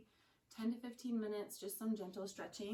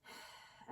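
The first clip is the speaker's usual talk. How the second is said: soft voiceless sighing breath out; long exhale; 12 dB quieter than usual. Shown as a demonstration. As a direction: let the breath go quietly, without voice. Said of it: "Exhale, let yourself become".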